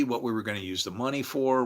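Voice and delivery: usual speaking voice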